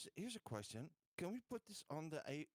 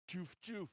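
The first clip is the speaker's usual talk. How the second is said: playful imitated blaster shots, pitched up; mock gun sound; 6 semitones higher than usual. Player imitating the new gun firing for the group, playful sound effect. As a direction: make the plasma gun go choof choof.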